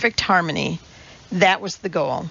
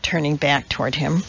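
plain reading voice

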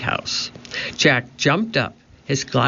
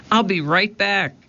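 higher-pitched call; 6 semitones higher than usual. A narrator's reading. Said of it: "Jack called".

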